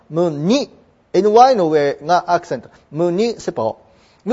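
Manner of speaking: ムニシパル with the accent on ムニ, then ル added after a pause; stress on ムニ. Shown as a demonstration. Teacher demonstrates ムニシパル to students, stressing ムニ.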